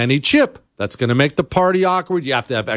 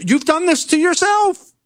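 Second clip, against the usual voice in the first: mock scolding. Scolding nacho eaters, high pitch.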